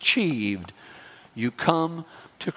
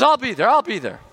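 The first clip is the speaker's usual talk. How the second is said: eager loud reply; 7 semitones higher, 6 dB louder, faster than usual. Retold or imitated. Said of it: "He says".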